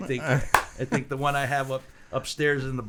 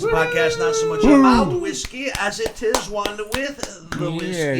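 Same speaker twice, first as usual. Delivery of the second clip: announcer intro; 10 semitones higher, 7 dB louder than usual. Acted. Podcast intro show opener.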